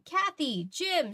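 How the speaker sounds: high, glad calls of the names; cried out. Acted. Nancy greeting friends at the door, glad cry. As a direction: greet them with a happy cry. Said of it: "she cried out".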